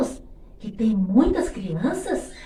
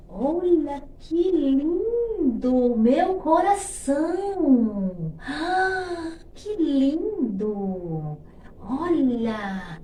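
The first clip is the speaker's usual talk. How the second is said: slow, high, drawn-out cooing; slow cooing wonder; 4 semitones higher, 3 dB louder, much slower than usual. Acted. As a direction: coo over it like a treasure. Crooning over a heart.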